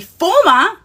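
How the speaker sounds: former called out high and loud; punched emphasis. Real, not acted. Inviting fans to say it along, a loud punched former. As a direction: hit the word former like a chant for the crowd. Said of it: "yes, say it with me".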